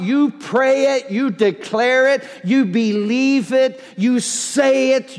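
normal talking voice

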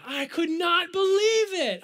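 high, loud, drawn-out mock outrage; livid. Preacher recalling the cast list, in hammed-up livid disbelief. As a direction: wail it in theatrical disbelief. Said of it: "I was livid".

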